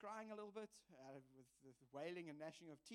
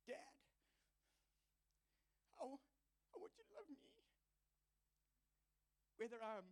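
put-on child's plea; 10 semitones higher than usual. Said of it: "he's actually going".

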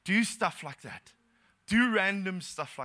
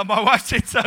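loud comic blurt; blurted excuse; 4 semitones higher, 10 dB louder than usual. Retold or imitated. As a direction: blurt it loudly, passing the blame.

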